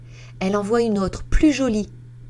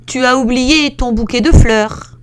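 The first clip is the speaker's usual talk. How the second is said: higher, loud calling out; middle voice shout; 6 semitones higher, 9 dB louder than usual. Acted. Middle bear calls about the forgotten flowers, loud. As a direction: call it after her. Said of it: "lui crie de sa moyenne voix".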